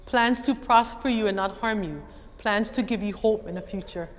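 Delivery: usual speaking voice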